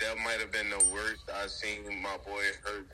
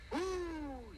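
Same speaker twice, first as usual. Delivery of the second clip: long, very high, soft whoop; excited whoop; 6 dB quieter than usual. Real, not acted. Host pleased by London Super Bowl news, high whoop. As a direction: let out a drawn-out high "woo".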